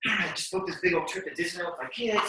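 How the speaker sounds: ordinary talk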